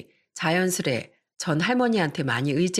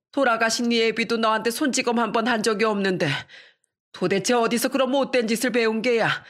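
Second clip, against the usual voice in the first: grandmother scolding; 5 semitones higher than usual. Acted. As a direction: scold your grown son like a fierce old grandma. (아들을 꾸짖는 할머니의 높고 큰 목소리)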